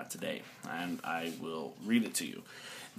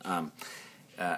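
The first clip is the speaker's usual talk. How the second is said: ordinary talk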